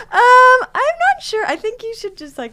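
mocking squeaky high voice, sped up; hammed-up high-pitched imitation of friend. Teasing her friend in a high mock voice. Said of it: "and you're like".

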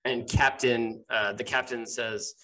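ordinary talk

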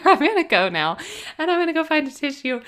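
laughing through happy tears; teary and giddy. Real, not acted. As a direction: blurt it out, about to cry from joy. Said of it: "So I'm unwell over here".

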